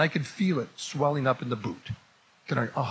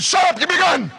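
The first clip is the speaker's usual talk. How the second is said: very high, loud yell; furious shouted order; 8 dB louder than usual. Acted. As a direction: explode at the friend's objection. Shouts demand after friend objects.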